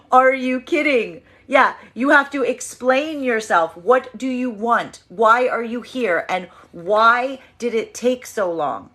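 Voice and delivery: slow, high, loud demand for answers; incredulous. Acted. Feminine confronts a returning old flame, incredulous. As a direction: drag out each question, indignant. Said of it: "this is a feminine energy being like".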